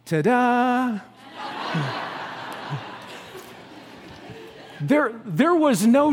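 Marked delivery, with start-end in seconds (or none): 0.0-1.0 put-on high, showy little-girl ta-da; mock showy child ta-da; child's ta-da. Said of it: "she goes". 1.4-3.4 quiet, breathy laugh; quiet amused laugh; soft breathy laugh. Real, not acted. Laugh after the story.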